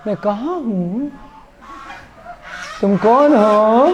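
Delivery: slow, put-on bewildered voice of one just come round; fake dazed waking. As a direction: play the monkey pretending to wake from a faint. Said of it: "acting दिखा दी". (होश में आने का नाटक करते बंदर की धीमी, हैरान आवाज़)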